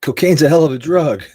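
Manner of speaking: loud, high-pitched hammed-up quote; mock catchphrase delivery. Streamer quoting a catchphrase to viewers, hammed-up and loud. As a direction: belt it out like a comic catchphrase. Shouts a well-known catchphrase.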